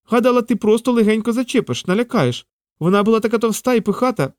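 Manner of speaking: high-pitched squirrel excuse; protesting squirrel. Acted. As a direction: protest in a high little creature voice. Squirrel defends herself to Peter.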